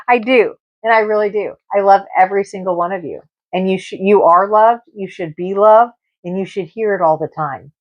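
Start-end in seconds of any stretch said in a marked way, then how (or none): none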